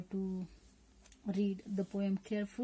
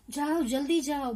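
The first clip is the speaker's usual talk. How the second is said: raised, quick acted order; urgent urging; 5 semitones higher, 4 dB louder, much faster than usual. Translating the poem's order to the child, urgent urging. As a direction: hurry them along at a brisk pace.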